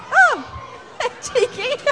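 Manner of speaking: laughing exclamation. Real, not acted. Kids throw balls back.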